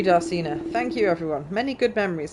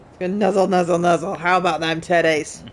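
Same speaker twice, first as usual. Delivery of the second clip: a touch higher, dazed then delighted hero; lightly voiced game character; 6 semitones higher, 3 dB louder than usual. Gamer reading the revived character greeting her friend, lightly voiced. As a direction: play the woken hero, surprised then gushing.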